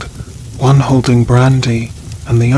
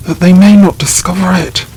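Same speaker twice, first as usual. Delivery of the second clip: higher, louder and fast; frantic burst; 5 semitones higher, 4 dB louder, faster than usual. A narrator's reading. Baron hiding his brother's grave, fast high shout. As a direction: blurt it out in a feverish rush. Madman tramping down grave earth.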